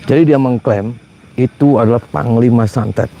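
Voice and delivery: normal talking voice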